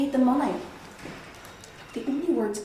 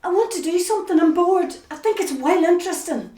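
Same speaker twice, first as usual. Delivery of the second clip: louder, higher, insisting; raised voice; 7 semitones higher, 7 dB louder than usual. Acted. Wife raising her voice at her husband. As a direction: push back hard at him.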